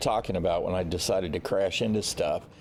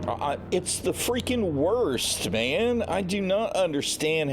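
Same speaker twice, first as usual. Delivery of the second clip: exasperated; 9 semitones higher than usual. Real, not acted. Complaining about navigation.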